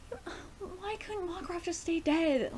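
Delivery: high, slow whine of despair; deflated high-pitched moan; real lament. Real, not acted. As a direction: plead it helplessly, drawn out. Laments the character's revival.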